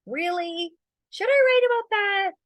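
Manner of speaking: high, loud mock voice of a hesitant beginner; mock doubtful writer. Mocking new writers who doubt their idea, high and loud. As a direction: ham up the nervous newbie second-guessing. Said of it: "they're like".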